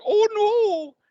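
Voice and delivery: very high, loud squeal; mock Giles. Giles horrified at the news, loud high squeal. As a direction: shriek it in horror. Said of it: "He's like".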